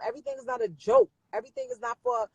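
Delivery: high, fast exasperated scold. Real, not acted. Scolding live viewers who treat everything as a joke, exasperated. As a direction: let the frustration spike up.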